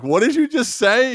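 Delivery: shouted high disbelief; mock doctor, stunned and loud; incredulous doctor. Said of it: "the doctor's like".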